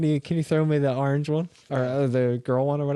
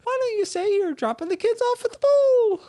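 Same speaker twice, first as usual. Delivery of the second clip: very high puppet voice giving cheeky advice; chirpy talking toilet; 3 dB louder than usual. Acted. Ventriloquist voicing toilet puppet.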